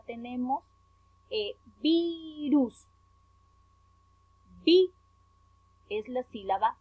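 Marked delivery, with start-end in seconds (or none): 1.8-2.9 "virus" drawn out, VI held long and strong; stress on VI in virus. Shown as a demonstration. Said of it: "se encuentra la mayor fuerza de voz". 4.6-4.9 "Vi" set apart as the strong syllable; stressed syllable alone. Shown as a demonstration. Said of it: "esta sílaba con mayor intensidad".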